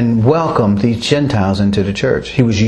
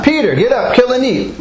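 higher-pitched mock command; lightly voiced divine command; 10 semitones higher than usual. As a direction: retell an order, pitched up and insistent. Voices God's command a third time.

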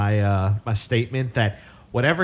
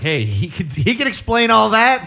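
excited mock pitch; 5 semitones higher, 5 dB louder than usual. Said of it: "They're like".

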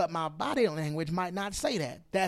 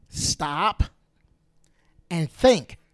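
"Stop" rising, a pause, then "and think" much louder; loud emphatic command; 8 dB louder, much slower than usual. Shown as a demonstration. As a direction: build to a loud punch on "think". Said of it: "repeats the command, even louder".

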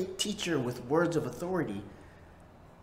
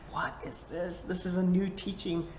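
awed whisper; 5 semitones higher than usual. Retold or imitated. Amazed crowd quoted in whisper.

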